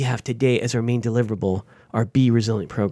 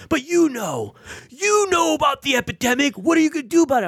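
much higher mimicked voice, accusing; angry veteran pressing; 13 semitones higher than usual. Veteran caller demanding action, imitated high and accusing. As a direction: push him to act, mock-angry. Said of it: "an angry Vietnam, uh, era, uh, veteran".

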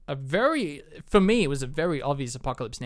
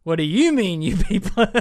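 louder, higher indignant retort; indignant film character; 5 semitones higher, 7 dB louder than usual. Retold or imitated. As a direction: throw the challenge back, loud and high.

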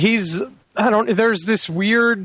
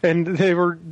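ordinary talk, nothing marked

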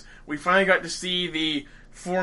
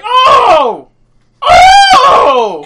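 excited shouting; 13 dB louder than usual. Retold or imitated. Excited fanboy reaction to preview.

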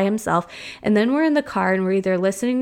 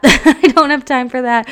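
laughing it out, higher and louder; 5 semitones higher, 7 dB louder than usual. Real, not acted. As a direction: say it through a laugh. Repeated line, second with laugh.

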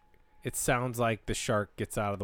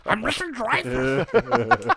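mock voice; 12 semitones higher, 8 dB louder, much slower than usual. Mock answer to where he's from.

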